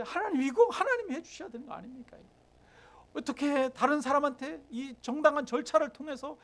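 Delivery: high, insistent outpouring to God; acted aggrieved prayer. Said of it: "그러면서 하나님 앞에". (억울함을 호소하는 기도 흉내)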